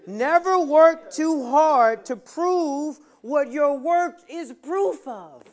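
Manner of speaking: slow, high, loud preaching cry; full-force preaching. Acted. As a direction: hammer each word home from the pulpit.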